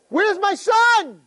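high, drawn-out cry; urgent demand. Retold or imitated. King demands his son from the old man, high urgent cry. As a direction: call out desperately for the answer.

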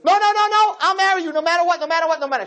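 high, fast, pleading reassurance; eager girl. Retold or imitated. Bride assuring the groom she will marry him, high and eager. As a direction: rush to promise him. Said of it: "So she goes".

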